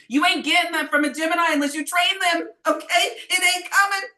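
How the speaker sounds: high, loud exasperated rant; worked-up rant. Real, not acted. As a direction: let it all boil over. Ranting about Gemini partners.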